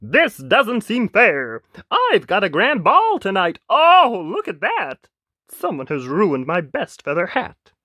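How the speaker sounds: high, loud, sulky prince; sulking prince. A narrator's reading. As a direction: whine like a prince whose evening is spoiled. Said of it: "He sulked to the other".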